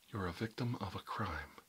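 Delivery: ordinary talk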